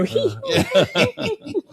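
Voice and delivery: high, mocking hee-hee giggle. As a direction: giggle at the hopeful parents. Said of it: "And I go".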